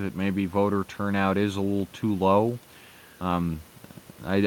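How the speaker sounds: ordinary delivery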